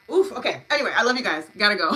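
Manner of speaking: bubbling over while hurrying off; excited sign-off. Real, not acted. Host rushing to end stream.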